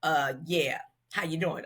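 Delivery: low, soft-spoken imitation. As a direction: quote him low and understated. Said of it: "Prince stuck his head in the door".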